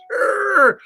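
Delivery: high strangled urgh of frustration; chef's angry scream. Acted. Chef screams over his lost recipe book. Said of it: "he's screaming".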